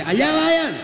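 very high, sustained call; calling from afar. Acted. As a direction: send the voice out across the water. Lord calls from the shore. (gritando de lejos, muy agudo)

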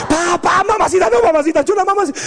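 high, hammed-up begging; mock pleading. Child begs mom during a beating, high pleading. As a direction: imitate a kid frantically sweet-talking mom.

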